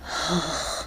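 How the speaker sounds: soft weary exhale; short, quiet sigh. A narrator's reading. Jack on seeing Santa arrive, soft sigh. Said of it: "Jack sighed".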